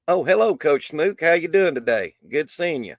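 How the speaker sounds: high, bright hello to a viewer; cheery greeting. Real, not acted.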